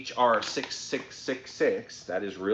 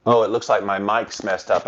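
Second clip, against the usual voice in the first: ordinary delivery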